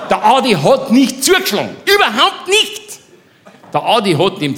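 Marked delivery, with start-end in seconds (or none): 0.1-3.0 pitched up, emphatic and laughing; swearing Adi never hit him. Real, not acted. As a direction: insist with a laugh. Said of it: "Ich schwöre jeden Eid".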